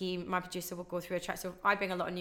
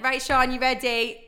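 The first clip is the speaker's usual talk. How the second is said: louder, slow, building hype; hype-up cue; 5 semitones higher, 10 dB louder, slower than usual. Retold or imitated. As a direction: wind the caller up before going live. DJ cueing a caller.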